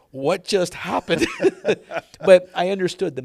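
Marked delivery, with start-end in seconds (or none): 0.1-1.3 slow, higher, loud disbelief breaking into laughter; laughing bewilderment. Retold or imitated. Golfer after mentor walks away, laughing bewilderment. 1.3-2.2 high, loud burst of laughter; real laugh. Real, not acted. Storyteller amused by his own shock, laughing.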